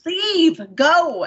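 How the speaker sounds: retold order. Woman retells ordering her ex away, firmly. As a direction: shoo him off. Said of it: "And I'm like".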